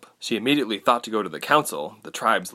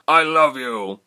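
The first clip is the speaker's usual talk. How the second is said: loud, raised father's farewell shout; Jonan roaring; 5 semitones higher, 6 dB louder, slower than usual. A narrator's reading. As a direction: shout love across the fray. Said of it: "he roared in a voice".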